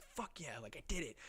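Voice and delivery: very soft, private celebration; hushed triumph. Retold or imitated. Boy to himself after his first kiss, hushed triumph. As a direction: savour it under your breath.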